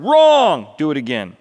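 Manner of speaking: high, loud bellow; explosive shouted correction; roar. Acted. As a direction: roar it like a furious director. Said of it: "he roared".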